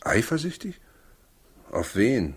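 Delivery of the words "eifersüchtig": a much higher, incredulous echo; surprised echo. Acted. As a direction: toss the word back in disbelief. Husband echoes surprised question. (überrascht und ungläubig nachgefragt)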